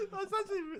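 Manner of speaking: high laughter near crying; laughing. Real, not acted. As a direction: laugh breaking into dismay. Laughter turning to distress.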